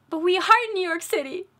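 a mother losing patience with bad directions; exasperated outburst. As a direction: cry out in disbelief. Mom exasperated at attendant.

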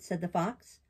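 usual speaking voice

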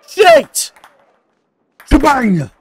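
loud high yelp; sudden loud outburst; shouted exclamation. Real, not acted. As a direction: yell it out at the screen. Game reaction.

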